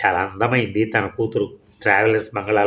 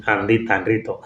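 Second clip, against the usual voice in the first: ordinary delivery, nothing marked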